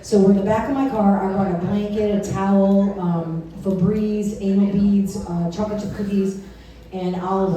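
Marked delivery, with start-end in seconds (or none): none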